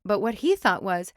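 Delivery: plain reading voice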